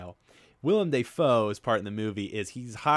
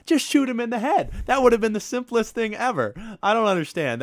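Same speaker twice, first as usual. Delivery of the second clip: exasperated rant; 12 semitones higher, 7 dB louder than usual. Real, not acted. Ranting about a dumb movie scene.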